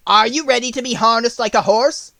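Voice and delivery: mock the lion with the question; witch taunting. A narrator's reading. Witch taunting the lion at the gate, a touch mocking. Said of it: "came to the gate at noon and asked".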